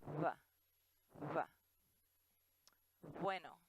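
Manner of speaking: V with lips vibrating, not closed; Spanish B/V. Shown as a demonstration. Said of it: "vibrate your lips".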